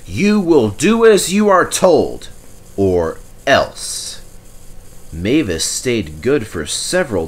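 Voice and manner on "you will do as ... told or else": a firm stern warning. A narrator's reading. The manager warning Mavis, stern. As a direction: warn her firmly. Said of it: "said the manager sternly".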